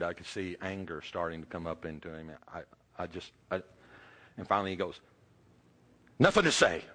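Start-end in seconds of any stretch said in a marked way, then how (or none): 6.2-6.8 curt angry retort. Retold or imitated. Angry father cuts off question.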